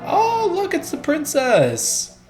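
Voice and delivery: high, sweet princess voice. Acted. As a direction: play a dainty princess, high. Voicing Peach in the game.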